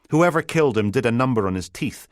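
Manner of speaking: ordinary delivery, nothing marked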